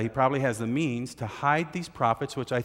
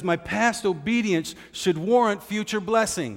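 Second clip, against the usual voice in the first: higher, louder entitled voice; self-righteous claim; 8 semitones higher, 4 dB louder than usual. Retold or imitated. Obadiah insisting to Elijah, mock entitled. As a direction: claim a reward you feel owed. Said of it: "He says".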